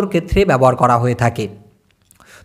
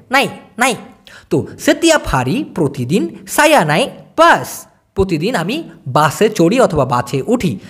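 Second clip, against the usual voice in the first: ordinary talk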